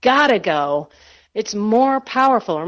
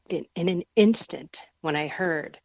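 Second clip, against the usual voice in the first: ordinary talk, nothing marked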